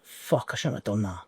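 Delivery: low, quiet curse under the breath; hushed inner voice cursing a mistake; muttered self-reproach. Retold or imitated. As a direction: mutter it to yourself in regret.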